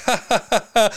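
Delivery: high, staccato fake laugh; imitated forced laughter. Mimicking the movie crowd, forced laughter.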